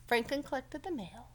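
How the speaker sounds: usual speaking voice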